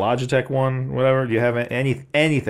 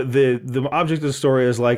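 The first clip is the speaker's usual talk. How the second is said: ordinary delivery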